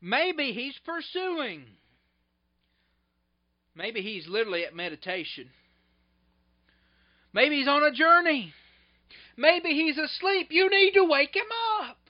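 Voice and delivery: sarcastic. Acted. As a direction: Elijah mocking Baal's prophets, sarcastic and rising. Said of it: "He's being sarcastic".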